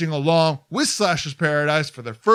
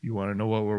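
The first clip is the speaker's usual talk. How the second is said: hushed scared; 8 semitones lower, 7 dB quieter, faster than usual. Pretend. Mock scared hushed exchange.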